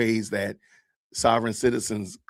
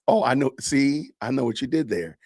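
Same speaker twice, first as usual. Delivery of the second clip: ordinary talk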